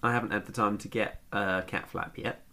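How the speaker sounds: ordinary talk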